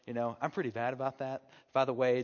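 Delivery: ordinary talk, nothing marked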